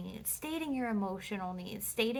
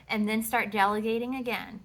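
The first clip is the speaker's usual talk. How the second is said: ordinary talk, nothing marked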